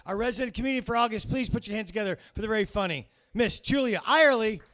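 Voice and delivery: loud, high, building emcee announcement; MC introduction. Real, not acted. MC bringing the resident comedian onstage, hyped. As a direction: whip up applause for the next act.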